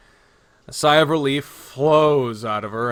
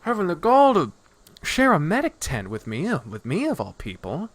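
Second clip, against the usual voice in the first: lightly acted taunt, starting high and settling down; Nadja, brash and taunting; 10 semitones higher than usual. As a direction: needle him in disbelief at his gall. Voices Nadja taunting Solaire.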